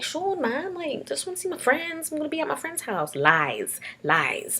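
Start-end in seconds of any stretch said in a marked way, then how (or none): 0.0-3.1 higher pleading younger sister; pleading whine. Retold or imitated. Younger sister pleading with brother to go out, whiny. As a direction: beg your brother, whiny. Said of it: "And I was like".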